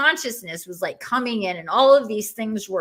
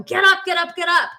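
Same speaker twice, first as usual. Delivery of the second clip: higher, louder insistent get up; shouted command; 9 semitones higher, 6 dB louder, faster than usual. Retold or imitated. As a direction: yell it urgently. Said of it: "somebody screaming in my face".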